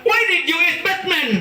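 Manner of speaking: very high put-on American question; mock American puzzlement. American man puzzled by the answer, high put-on accent. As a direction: sound baffled by the answer.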